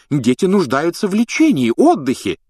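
high, loud protest; indignant. A narrator's reading. Matron arguing the children need rest, indignant. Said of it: "возмущённо заклокотала мадам Помфри".

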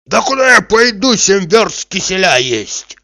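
loud, high-pitched objection; indignant, drawn-out protest; protesting excuse. A narrator's reading. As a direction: complain your way out of going. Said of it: "отговаривался Захар".

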